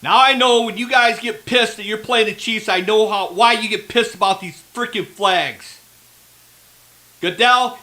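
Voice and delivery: ordinary talk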